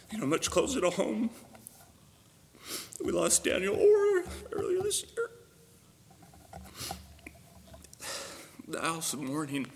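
choking up in real grief; fighting back tears on the name; real tears for a lost friend; voice breaking. Real, not acted. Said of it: "I gotta stop doing this".

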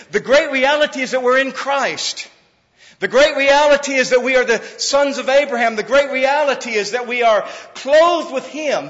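loud, high preacher's proclamation; ringing sermon climax; preaching at full force. Acted. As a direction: drive the refrain home.

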